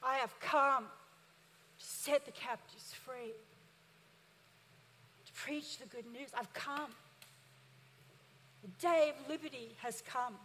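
quiet, intimate reading of Jesus' words; hushed. Acted. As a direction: let it sink to a near hush. Jesus quoted, voice drops right down.

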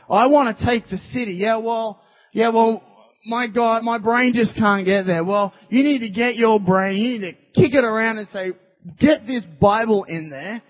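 loud, high, driving sermon voice; full-force preaching. Acted. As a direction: act out the doubter, then hammer the answer home.

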